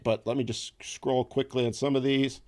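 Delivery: usual speaking voice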